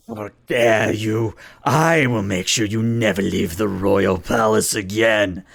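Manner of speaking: slow, menacing villain prince; enraged prince. Acted. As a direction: threaten with drawn-out royal outrage.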